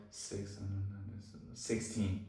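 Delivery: SIX trailing off, then a soft SIXTEEN; stuttering, hesitant. Shown as a demonstration. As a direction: stumble on SIX before getting out SIXTEEN. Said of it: "um, or stutter".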